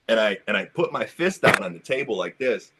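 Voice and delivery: usual speaking voice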